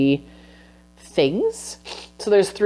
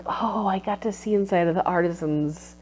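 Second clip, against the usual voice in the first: soft, fading wonder; awed hush; 3 semitones higher, 8 dB quieter than usual. Retold or imitated. Textile researcher's awe at the artisan's vision, hushed. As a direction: let the words sink to near silence in awe. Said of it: "we had this moment where it was like".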